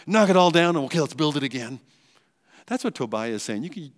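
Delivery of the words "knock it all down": playful shout. Retold or imitated. Knocking the tower down.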